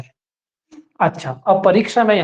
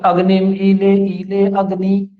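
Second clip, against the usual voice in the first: recitation demo; slower than usual. Shown as a demonstration. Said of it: "इसमें पहले अक्षर के साथ दूसरे अक्षर को बोला जाता है".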